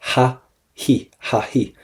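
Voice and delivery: "ha, he" said with a breathy h and no th sound; Irish h for th. Shown as a demonstration. Teacher showing students how Irish say th, a plain breathy h. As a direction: breathe out a clear h in "ha, he", never a th. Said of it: "We pronounce the H".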